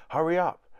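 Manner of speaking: urgent call. A narrator's reading. Cook hurries children.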